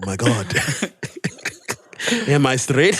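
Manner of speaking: normal talking voice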